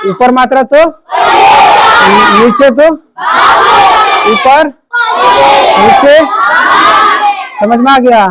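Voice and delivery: high, loud cue left hanging for an answer; loud drill prompts. Real, not acted. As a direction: fire short cues at the whole class. Rapid call-and-response prompts to class.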